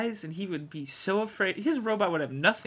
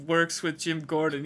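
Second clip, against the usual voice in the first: ordinary talk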